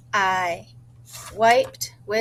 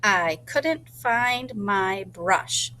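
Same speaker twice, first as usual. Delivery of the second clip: slow sentence; 2 semitones lower, much slower than usual. Shown as a demonstration. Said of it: "able to remember what it is".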